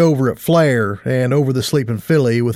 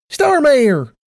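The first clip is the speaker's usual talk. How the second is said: excited greeting; 13 semitones higher than usual. Acted. Tight Lips hugs her gladly.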